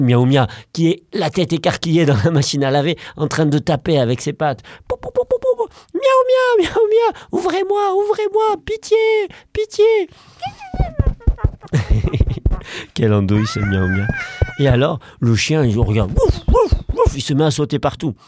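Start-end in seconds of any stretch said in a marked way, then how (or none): 4.9-5.7 high tapping noises. Acted. Cat tapping paws. 5.9-9.8 very high squeaky begging; pleading cat. Acted. Trapped cat begging to be let out, squeaky pleading. 10.4-12.9 high squeaky giggle. Acted. After the cat voice. 16.1-17.1 high excited woofs; dog bark. Acted. Dog alarmed by the cat, yapping.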